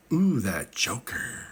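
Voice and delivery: slow drawn-out Joker purr; imitating a creepy Joker voice; creepy Joker smile. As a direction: stretch it out like a creepy grin. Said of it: "screams like".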